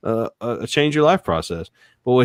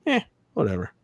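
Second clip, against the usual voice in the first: softer, noncommittal imitated reply; mock indifference; 5 dB quieter than usual. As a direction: play the partner who won't decide. Acting out indifferent partner choosing movie.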